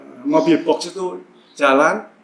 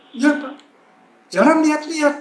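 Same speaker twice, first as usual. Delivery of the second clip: high, raised-voice mock scolding; imitated angry yelling; 9 semitones higher, 4 dB louder than usual. Gate guard telling off the driver, mock angry yell. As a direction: scold like an irritated guard, retold. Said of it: "dia teriak-teriak".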